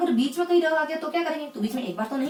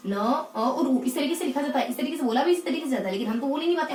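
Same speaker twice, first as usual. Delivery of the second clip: ordinary delivery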